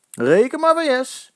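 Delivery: high, slow mocking jeer. A narrator's reading. Jaap taunting the rival fans, mocking jeer. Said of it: "hoont Jaap Roos".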